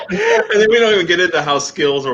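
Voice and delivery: laughing. Real, not acted. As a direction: talk through a fit of laughter. Joking about the complexity.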